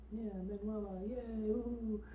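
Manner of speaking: high, restrained little cheer; playful high-pitched yay for a new arrival; mock cheer.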